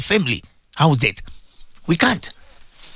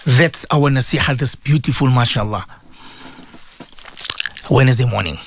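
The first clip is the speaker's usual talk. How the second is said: low-pitched, measured admonition with pauses; solemn reflective preaching; 9 semitones lower than usual. Acted. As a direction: lead them into self-examination in a low voice. Leads listeners in self-examination.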